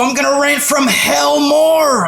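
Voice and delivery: a hint of a comic screaming at the crowd; Sam Kinison. Ranter warming up his viewers, a put-on Sam Kinison voice. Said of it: "channeling fucking Sam Kinison here".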